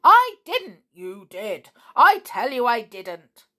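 loud, high bickering denial; squabbling shouts. A narrator's reading. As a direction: argue back and forth like quarrelling goblins. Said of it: "shouting in their usual tiresome way".

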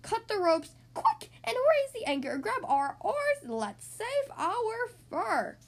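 high, urgent orders, drawn out at the end; commanding yell. A narrator's reading. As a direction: take charge of panicking sailors. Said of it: "In a commanding voice, he yelled".

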